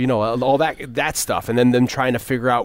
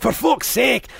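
Scotty impression; 9 semitones higher than usual. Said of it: "Scotty's like".

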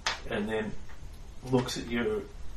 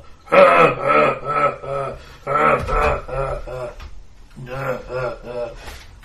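loud booming troll laugh; confident troll laugh; 5 dB louder than usual. Acted. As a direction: laugh like a smug monster. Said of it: "laughs very confidently".

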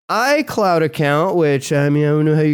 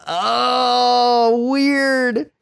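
mock surprise; 9 semitones higher than usual. Real, not acted. Mock reaction to story.

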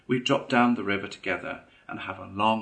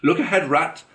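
sharp warning cry, louder and higher; sudden cry; 8 semitones higher, 10 dB louder than usual. A narrator's reading. Mole warning Rat before the crash, sudden cry. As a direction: yell a sudden alarm. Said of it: "cried the Mole suddenly".

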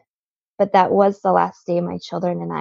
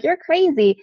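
amused disbelief; 9 semitones higher than usual. Retold or imitated. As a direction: scoff it lightly, as if retelling his reaction. Voicing husband's amused disbelief.

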